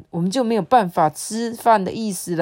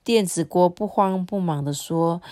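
ordinary delivery

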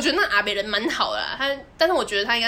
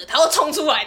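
usual speaking voice

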